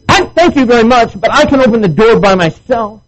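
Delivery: high, loud offended lady; mock-prissy high offended voice; indignant lady. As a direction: huff like an insulted woman. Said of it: "She got upset".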